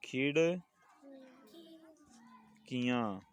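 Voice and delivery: खिड़कियाँ split into खिड़ and कियाँ with a long pause; teacher breaking up खिड़कियाँ, softly drawn out; syllable by syllable. Shown as a demonstration. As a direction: stretch खिड़कियाँ very slowly, in two parts. Teacher models word for class.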